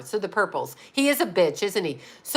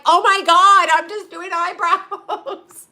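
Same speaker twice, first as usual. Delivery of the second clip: higher, louder, slow, laughing squeal of "Oh my God"; flustered squeal; 8 semitones higher, 5 dB louder, slower than usual. Retold or imitated. Jenny shocked at the waxing, flustered squeal. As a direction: play Jenny caught off guard, squealing. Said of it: "It was funny the way she said it".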